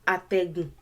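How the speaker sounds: Atẹ́gùn said over and over, each tone placed; Yoruba word repeated. Shown as a demonstration. Teacher drilling a Yoruba word, repeating it for learners. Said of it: "That's how to say the word properly".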